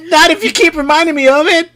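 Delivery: angry yell. Acted. Kid yells back at friend.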